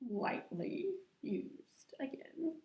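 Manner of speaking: whispered aside, slow; joking whisper. Real, not acted. To her viewers, teasing about "older", whispered. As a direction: breathe it as a secret joke.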